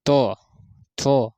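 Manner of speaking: तो थो said loud with teeth together; dental demo. Shown as a demonstration. Teacher demonstrates dental तो थो for the class. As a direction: hit तो and थो cleanly against teeth. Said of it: "जैसे तो".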